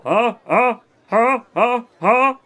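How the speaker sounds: high, loud, separate mock laughs; forced laugh. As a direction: push out each "huh" as an exaggerated laugh. Jokey forced laughs after praise.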